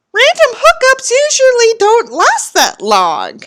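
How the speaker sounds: ordinary talk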